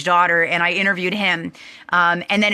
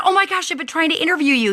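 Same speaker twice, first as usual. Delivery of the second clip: excited gush; 8 semitones higher, faster than usual. Retold or imitated. Excited meeting the sought person.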